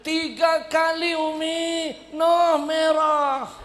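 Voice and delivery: high, loud, slow whiny fake sob; fake crying. Son fake-crying to his mother, high and loud. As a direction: whine like a crying kid.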